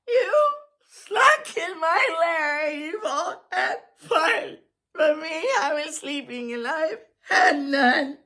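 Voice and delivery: high, crying complaint of a hurt bear; whimpering bear. A narrator's reading. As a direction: wail it like a wronged child. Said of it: "And he whimpers".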